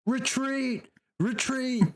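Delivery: high-pitched acted yell. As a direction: cry out the order to the troops, voice way up.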